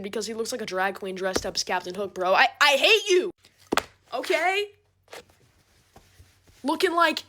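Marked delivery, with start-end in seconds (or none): 2.3-4.7 loud stuttering hate you; mock outburst. Telling off her friend's boyfriend, a loud mock outburst. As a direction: fling it out like a mock tantrum.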